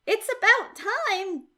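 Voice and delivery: put-on a cheerful, relaxed young cat who isn't scared at all; a hint of a breezy kid cat; David the cool cat. David greets the aliens.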